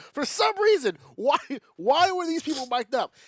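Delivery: high, loud disbelief; incredulous. Real, not acted. Baffled by the mic'd-up security guards, incredulous.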